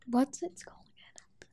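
soft whispered question; whispered aside. Real, not acted. Player asking the game master for a name, whispered aside. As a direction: whisper it out of character.

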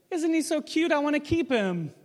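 put-on high-pitched cooing over a baby; cooing princess. Acting Pharaoh's daughter finding baby.

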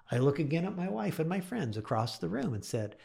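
ordinary delivery

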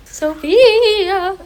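high, eerie ghostly voice; spooky ghost moan. Pretend. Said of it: "Oh, very good ghost impression there".

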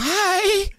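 tiny, very high squeaked hello; timid mock greeting. Comic greeting Rogan while intimidated, high timid squeak. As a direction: squeak it, sending up your own nerves.

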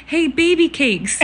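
put-on high, laughing little boy's voice; cheeky toddler imitation. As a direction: mimic a cheeky little boy, high and giggly. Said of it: "and he goes".

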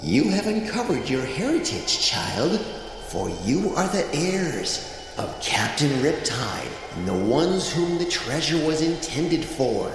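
soft mystical voice. Acted. Shell gives final message.

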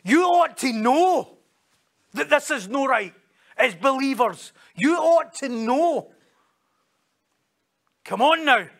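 high, loud, drawn-out scolding; indignant, forceful chiding; exasperated reproach. Shown as a demonstration. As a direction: tell them off as if they know better. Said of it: "That's what he's saying".

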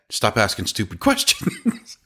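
mock scolding breaking into laughter; joking retort. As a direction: laugh through the last words.

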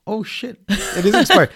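high-pitched imitation of startled agents; mock surprise. Border agents spotting an expired green card, mock surprise. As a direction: blurt it high, amused by the retelling.

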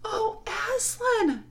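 high, drawn-out cry of amazement; long, high-pitched gasping call; awed cry. A narrator's reading. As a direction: cry out, frightened and glad at once. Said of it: "cried both the children".

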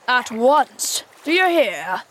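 loud captain's demand; commanding cry. A narrator's reading. Peter as Hook demanding obedience, commanding cry. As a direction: insist loudly, brooking no argument. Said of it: "cried Peter".

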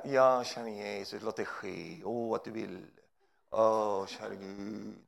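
low, soft, sighing holy voice; mock pious prayer. Preacher imitating churchgoers' sad prayer, soft and low. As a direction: murmur it like a sad devout soul. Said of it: "Och sen här".

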